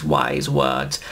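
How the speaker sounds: low, slow solemn villain; slow deep Davros. Acted. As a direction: drag it out low like a tyrant. Davros voice agreeing.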